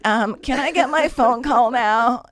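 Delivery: high, slow, careful request; trying to be nice. Retold or imitated. Inmate asks guard for a phone call, politely. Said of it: "I'm trying to be nice at this point".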